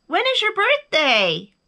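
high, loud eager question; thrilled bear calling far off; excited. A narrator's reading. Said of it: "Bear got very excited".

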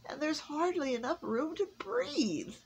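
high, pleading elf voice; the elf, near tears; distressed elf. A narrator's reading. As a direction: stammer out the workshop woes. Miserable elf complains.

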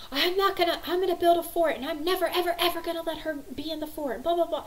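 high, loud mock squabbling; heated high-pitched sibling rant; imitated angry child. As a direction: mimic a kid's indignant vow. Ten-year-old son squabbling, imitated.

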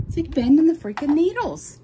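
annoyed cry at the machine; frustrated outburst. Real, not acted. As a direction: blurt it out, fed up with the bent needle. Needle keeps bending, frustrated outburst.

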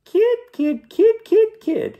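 tiny squeaky falsetto creature; loud, piping ant voice; very high voice. A narrator's reading. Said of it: "saying in a very high voice".

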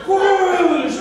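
high, loud whoosh of the drop. Retold or imitated. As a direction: swoosh down like the coaster. Coaster drop sound.

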